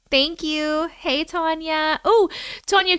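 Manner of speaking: normal talking voice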